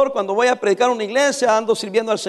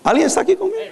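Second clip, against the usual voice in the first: calling out; 9 semitones higher, faster than usual. Real, not acted. Preacher checks congregation.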